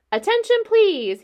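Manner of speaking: high, loud and firm, calling the whole barn. A narrator's reading. Wilbur calling out to the barn, loud and firm. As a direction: announce it firmly to everyone. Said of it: "he said in a loud, firm voice".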